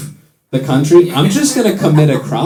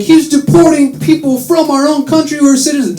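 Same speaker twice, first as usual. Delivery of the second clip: outraged; 10 semitones higher than usual. Retold or imitated. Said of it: "We're like".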